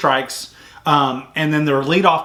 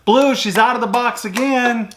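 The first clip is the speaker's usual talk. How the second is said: higher-pitched mock call from afar; retold yell; 8 semitones higher than usual. Fan calling to the umpire once more, high mock yell. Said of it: "I yelled to the umpire".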